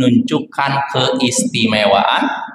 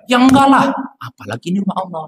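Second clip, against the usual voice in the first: put-on child's answer; 7 semitones higher than usual. Child answers ustadz's question.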